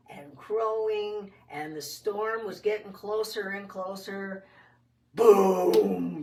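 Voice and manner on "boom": a big, much louder booming imitation; thunder boom. Storyteller imitating the thunder over the farmyard, booming loud.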